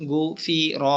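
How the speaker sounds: "গু ফি র" said as separate syllables. Shown as a demonstration. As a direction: pause briefly after "গু" and "ফি". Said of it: "Bangla উচ্চারণ পড়বেন একটা একটা". ("গু ফি র" একটা একটা করে উচ্চারণ)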